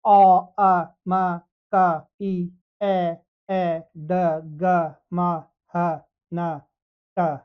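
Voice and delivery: first sound drawn out slow and loud, then the row read off; sound drill. Shown as a demonstration. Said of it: "पहले यहाँ से पढ़ना है". (बच्चों के लिए पंक्ति पढ़ना)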